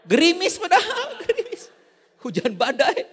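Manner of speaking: high, laughing disbelief. Real, not acted. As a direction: laugh at how trivial it was. (tertawa tidak percaya dengan nada tinggi)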